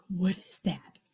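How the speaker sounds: breathless whispered question; hushed, puzzled whisper into the dark. Retold or imitated. Hearing a strange night call in the trees, whispering. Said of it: "so I was like".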